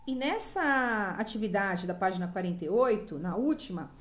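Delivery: ordinary talk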